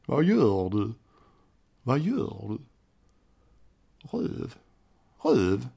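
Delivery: imitated Kristianstad Swedish; playful demo of Kristianstad dialect. Shown as a demonstration. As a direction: put on a local Kristianstad accent, phrase by phrase. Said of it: "prata lite som de gör i Kristianstad".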